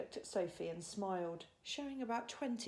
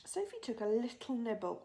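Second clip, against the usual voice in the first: plain reading voice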